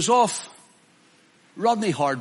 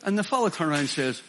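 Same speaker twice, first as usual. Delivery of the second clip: ordinary talk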